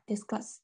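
"Discuss" stressed on its second syllable, -cuss. Shown as a demonstration. Teacher pointing students to the stress, "Discuss" stressed from the k. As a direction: put the weight on -cuss in "Discuss". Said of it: "这个重音是在".

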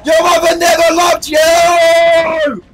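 high, slow battle yell; hammed-up war cry; screamed mock insult. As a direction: drag the last word into a shout. Yelled insult at game enemy.

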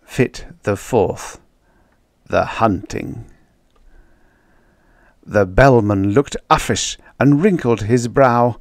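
ordinary delivery, nothing marked

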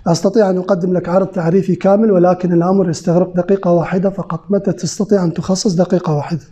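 formal fusha delivery of a sales invitation; Standard Arabic. Shown as a demonstration. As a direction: say it in careful classical Arabic, not dialect. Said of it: "أنا راح أقولها بالفصحى".

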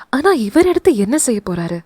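ordinary delivery, nothing marked

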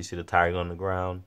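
usual speaking voice

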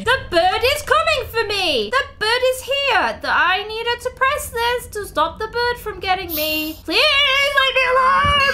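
high, loud terrified shrieking; screaming in real panic from the chasing bird; frightened yelling as the bird closes in; panicked scream. Real, not acted. Bird chasing her in game.

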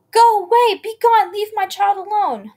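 high, loud screaming at the monster; shrieking to drive the monster off; desperate scream. A narrator's reading. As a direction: protective mother screaming. Mother shields child from monster.

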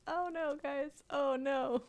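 dismayed oh no. Real, not acted. Bubble burst in her hair.

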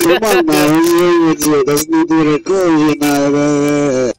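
loud, muffled voice inside a cup; cupped, blaring radio garble; mic in a cup. Pretend. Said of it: "Why did you stick your mic in a cup again?".

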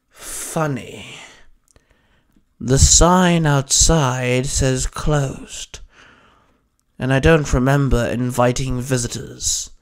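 slow, deliberate grunted character voice; unwelcoming grunt, long pauses between phrases; Hex. A narrator's reading. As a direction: drag the words out with dry menace. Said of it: "Hex grunted".

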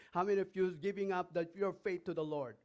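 ordinary talk

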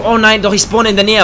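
ordinary talk

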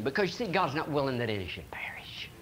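soft unvoiced whisper; quiet solemn whisper. Real, not acted. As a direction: whisper it like a tender secret. Preacher drops to solemn aside.

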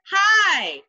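loud high holler. Acted. Grandma hollers at the boy to hide. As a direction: holler it at him. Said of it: "Grandma hollered".

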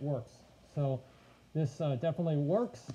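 muffled inside sealed mask; speaking behind mask faceplate; through a full face mask. Real, not acted. Seen 1: Full-face snorkel mask covers mouth and nose throughout the labelled words.

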